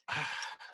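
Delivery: real, short unvoiced sigh of regret; dismayed sigh. Real, not acted. Colleague regretting they didn't get to this, dismayed sigh. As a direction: let out a disappointed sigh. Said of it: "this makes me sad".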